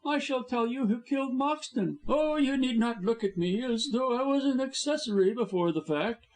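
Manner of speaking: gloating high villain voice; scheming blackmailer. A narrator's reading. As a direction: lay out the terms smugly. Blackmailer boasting.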